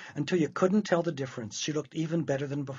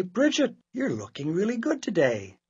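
high, louder lilt on Bridget; pleasantly surprised mother; 5 semitones higher, 5 dB louder than usual. Acted. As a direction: praise her with bright surprise. Mother compliments daughter.

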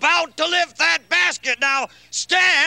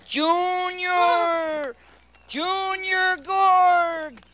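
long booming call across the distance; 3 semitones higher, much slower than usual. Acted. Calling out to Junior.

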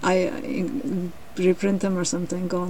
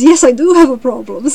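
loud, raised-pitch outburst; agitated lion; 4 semitones higher, 10 dB louder than usual. A narrator's reading. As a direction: blurt out the admission with force. Lion admits his problem, agitated.

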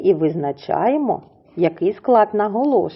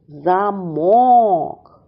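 "Замок" with a long, stressed final -мок; stress on second syllable; 3 dB louder than usual. Shown as a demonstration. Teacher showing children where the stress falls, long stressed second syllable. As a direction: draw out MOK in "Замок": za-MOOOK. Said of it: "Наголос падає на другий склад".

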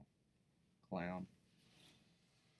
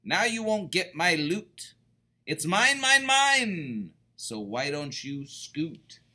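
higher and louder, climbing to a squeal on mine; a greedy pug's shrieking boast; gloating shout; 6 semitones higher, 9 dB louder than usual. Acted. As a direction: crow over the loot. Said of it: "shouted Pig".

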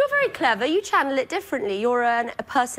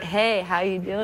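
lower-pitched American phone greeting; American accent; 4 semitones lower than usual. Shown as a demonstration. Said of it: "do the American accent again".

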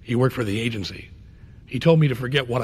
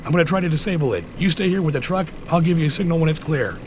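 pitched up, tense battlefield command; urgent combat orders; 6 semitones higher than usual. Acted. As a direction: call out quick orders to your squad under threat. Flashback to combat, giving orders.

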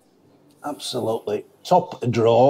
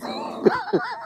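short high giggle; a real laugh at his own joke; laughing at his own fat joke; 6 dB quieter than usual. Real, not acted.

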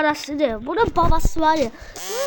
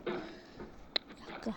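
hushed whisper; 8 semitones lower, 22 dB quieter than usual. Real, not acted. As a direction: drop to a whisper to stop. Gamer pauses suddenly, hushed.

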